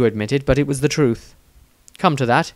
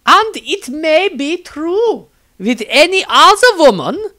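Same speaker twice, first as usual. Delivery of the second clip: high, loud, syrupy nun voice; a much higher, louder fervent sister; zealous nun; 13 semitones higher, 7 dB louder than usual. A narrator's reading. Nun Angelique sweetly insists to the wizard, high and loud.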